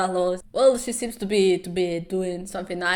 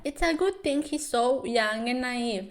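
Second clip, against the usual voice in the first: normal talking voice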